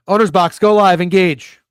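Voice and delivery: loud, high launch command; commanding engage. Pretend. Host kicking off the picks for fun, commanding. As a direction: call it out big, stretching "engage". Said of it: "I like saying engage".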